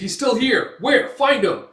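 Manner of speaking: high, loud barked orders; guards shouting. Retold or imitated. Guards searching the smoke for Guts, loud orders. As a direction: hunt him down, shouting. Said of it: "It's like".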